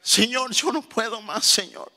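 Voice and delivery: high, softened cry of surrender; worn-out believer pleading with God; acted exhausted plea. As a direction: drop to a small, desperate prayer. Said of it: "Diciéndole".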